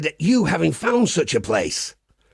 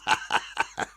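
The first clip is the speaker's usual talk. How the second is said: soft chuckle; 7 semitones lower, 8 dB quieter than usual. Acted. Chuckle after party joke.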